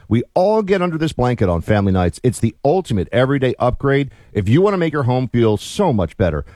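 relaxed host-read ad. Acted. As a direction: pitch the blankets like a friendly plug. Hosts read sponsor ad.

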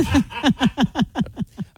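high-pitched burst of laughter. Real, not acted. Laughing at co-host's jab.

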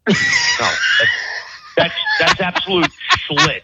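so fed up he yells and laughs at once; furious laughing rant. Real, not acted. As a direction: voice jumps way up, shouting through laughter. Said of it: "finding this so entertaining".

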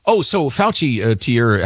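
usual speaking voice

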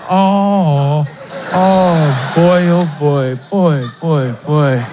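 put-on low, leering "oh boy, boy" groan; lecherous oh boy. Leering coworker ogling a woman, low oh-boy groan. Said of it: "he's just like".

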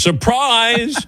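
high, drawn-out group greeting; mock party cheer. Party guests greeting the host, mock cheer. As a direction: imitate a crowd springing a surprise.

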